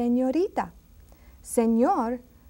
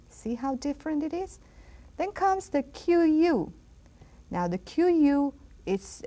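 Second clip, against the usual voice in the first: ordinary delivery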